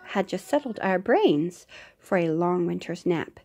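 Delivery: plain reading voice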